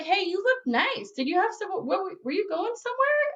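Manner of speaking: mock-casual retold niceness; casual friendliness. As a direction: lift the voice into a friendly question. Dealer turns friendly in retold scene.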